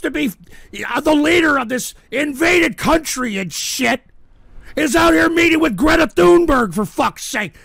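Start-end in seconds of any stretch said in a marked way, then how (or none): 4.7-6.7 high, loud incredulous rant; outraged shouting. Real, not acted. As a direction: shout it as if it were absurd. Host outraged over news item.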